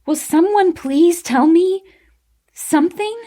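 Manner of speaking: loud, high, exasperated shout. A narrator's reading. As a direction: cry out, desperate for an answer. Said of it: "I shouted".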